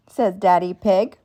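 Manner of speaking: ordinary delivery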